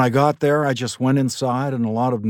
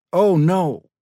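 high, loud anguished oh no; the mother's heart breaking; mother crying out in grief; dismayed; 8 semitones higher, 7 dB louder than usual. A narrator's reading. Mother hears of the shooting.

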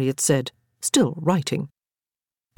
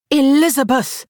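higher and louder rebuke; sharp scolding; 10 semitones higher, 5 dB louder than usual. A narrator's reading. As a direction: bark her name in reproach. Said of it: "Harriet scolded".

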